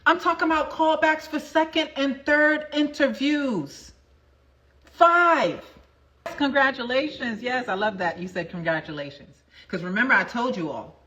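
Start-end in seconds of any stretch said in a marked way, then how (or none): 4.8-5.6 emphatic five. Real, not acted. Announcing the callbacks.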